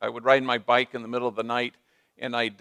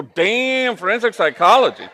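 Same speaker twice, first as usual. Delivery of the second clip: much higher and louder, amazed; impressed surprise; 8 semitones higher, 10 dB louder than usual. Retold or imitated. Priest reacting to the daughter's answer with loud amazement.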